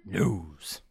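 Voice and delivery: low, drawn-out announcer word; deep announcer voice. Pretend. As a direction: drop the voice low for the title. Deep echo of segment title.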